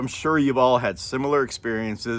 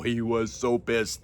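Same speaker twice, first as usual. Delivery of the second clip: usual speaking voice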